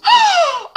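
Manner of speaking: sharp, high, loud gasp; shocked gasp. Real, not acted. Host reacting to the suitcase twist, sharp high gasp. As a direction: gasp like you're clutching your pearls. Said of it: "I'm clutching my pearls".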